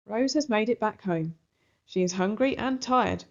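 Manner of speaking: usual speaking voice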